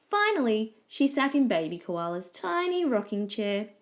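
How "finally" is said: usual speaking voice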